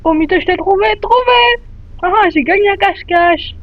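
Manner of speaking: high, loud victorious shout; climbing excited yell; triumphant. Real, not acted. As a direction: crow over finding her. Wins hide and seek.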